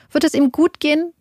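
worried caller begging for an answer; anxious plea. A narrator's reading. Anu begs the dispatcher to know if he will live, frightened.

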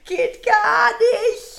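loud, high, drawn-out cry; slow mock outrage at full voice; exaggerated disapproval. Exaggerated verdict, hosts laughing.

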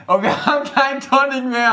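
laughing high; amused laughing complaint. Real, not acted. Laughing about missing tonic.

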